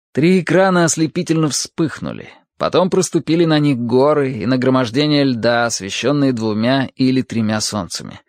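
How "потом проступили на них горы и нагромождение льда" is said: plain reading voice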